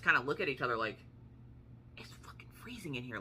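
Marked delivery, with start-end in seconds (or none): 2.0-3.2 hushed, voiceless whisper; whispered complaint about the cold; retold whisper. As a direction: whisper it as if others are asleep.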